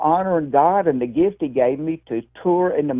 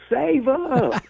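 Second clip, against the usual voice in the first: mock yell; 8 semitones higher than usual. Woman heckles from the back of the room, mock high yell. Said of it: "a woman in the back of the room yelled".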